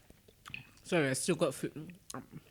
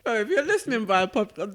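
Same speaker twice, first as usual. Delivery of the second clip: ordinary talk, nothing marked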